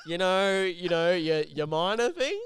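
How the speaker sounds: a mock-awkward boss pressing the point; awkward hinting boss. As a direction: spell out the awkward hint loudly.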